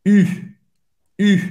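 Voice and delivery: short isolated "U", lips pursed saying I; pouted French "U" on its own. Shown as a demonstration. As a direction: say "U" as French [y], pouting round an EE. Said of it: "boca do U e falar I".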